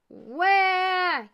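put-on high, loud baby ghost yell; baby's wail. A narrator's reading. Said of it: "yelled baby ghost".

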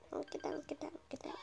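hurried whisper, get down; urgent whisper. Real, not acted. Urging to hide from killer.

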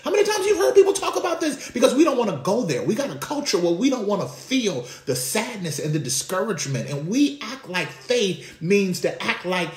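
preaching at full force. Acted. Preacher to congregation, rising to a full-force cry. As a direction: lift the congregation, preaching hard.

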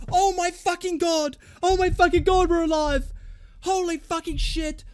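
high, excited disbelief; real overjoyed exclaiming; stunned elation. Real, not acted. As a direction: gasp it out high and thrilled. Disbelief after the goal.

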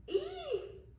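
frightened scream. Acted. Lily grabbed mid-story, shrieking high in fright. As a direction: squeal in sudden fright. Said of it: "screamed Lily".

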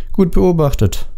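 high, cutting sarcasm; mock praise with some bite; biting. A narrator's reading. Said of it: "sagte sie bissig".

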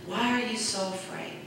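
soft, low, calm reproach; quietly chide, tender and low; gentle rebuke. Retold or imitated. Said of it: "he said this".

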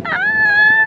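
very high squeal; excited squeal. Real, not acted. Squeal before showing the fit.

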